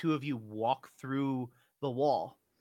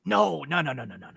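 hurried no; 7 dB louder, much faster than usual. Acted. GM rushes to correct player.

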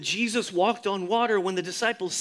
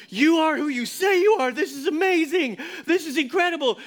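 high, loud, thrilled cheering; excited disciples; 8 semitones higher, 5 dB louder than usual. Acted. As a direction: cheer him like a hero.

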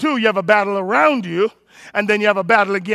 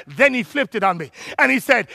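ordinary talk

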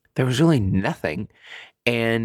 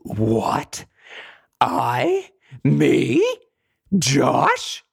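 stunned disbelief; 4 semitones higher, 4 dB louder, much slower than usual. Retold or imitated. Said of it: "I was like".

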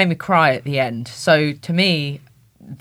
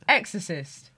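normal talking voice

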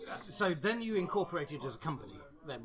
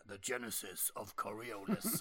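husky voice; 5 semitones lower, 5 dB quieter than usual. Real, not acted. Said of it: "Even in that voice".

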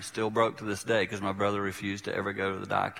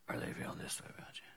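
hushed whisper, much softer; 16 dB quieter than usual. Shown as a demonstration. Preacher showing the congregation the other feeling, whispered. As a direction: drop right down to a whisper.

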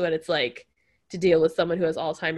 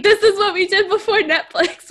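laughing mock realization; 11 semitones higher, 4 dB louder than usual. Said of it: "I was like".

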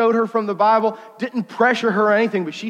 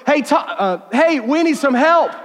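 raised, higher call for help; retold yell; 7 semitones higher, 4 dB louder than usual. Calling to his partner at the next house, retold yell. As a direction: yell over to a partner, half in earnest. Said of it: "so I yelled over".

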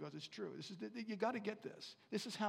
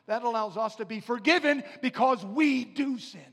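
loud, higher, driving preacher's fervour; preaching at full force; 6 semitones higher, 15 dB louder than usual. Acted. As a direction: build the congregation to a shout of praise. Preaching climax on forgiveness.

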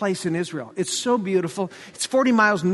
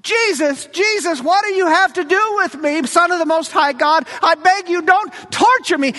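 mock scream; 7 semitones higher, 4 dB louder than usual. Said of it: "screaming at the top of his lung".

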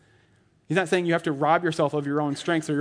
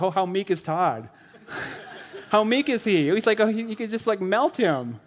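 mocking meek Todd; 4 semitones higher than usual. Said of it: "me laying in the fetal position".

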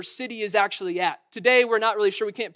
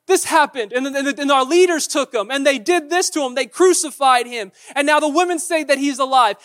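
loud, higher, urgent rush of news; agitated outpouring; 5 semitones higher, 8 dB louder than usual. Acted. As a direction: spill it all out, loudly and upset. Disciples pour out their story.

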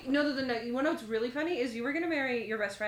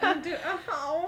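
laughing; 6 semitones higher, 3 dB louder, slower than usual. Retold or imitated. Mock reaction to lawyer plan.

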